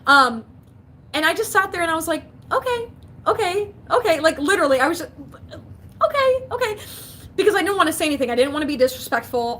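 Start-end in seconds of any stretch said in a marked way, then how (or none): 2.5-4.2 meek placating okays. Retold or imitated. Meekly taking the mother-in-law's rant. 6.1-6.8 meek placating okays. Retold or imitated. Meekly taking the rant.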